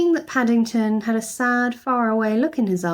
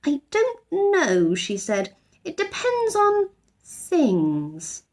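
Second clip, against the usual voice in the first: mysterious; 9 semitones higher, much slower than usual. A narrator's reading. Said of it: "looked round mysteriously".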